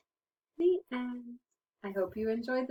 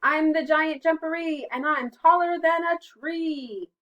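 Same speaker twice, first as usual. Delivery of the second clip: high, very loud storybook boast; towering mock-giant announcement; 8 semitones higher, 10 dB louder than usual. A narrator's reading. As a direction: boom it out, stretching the last words. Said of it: "he heard a loud voice".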